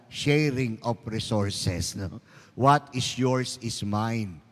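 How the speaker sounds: low, slow, sarcastic preaching; mock-wise irony. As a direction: lay it on slow like fake wisdom. Mocking rationalization of stolen boyfriend. (pabirong sarkastiko, mababa at mabagal)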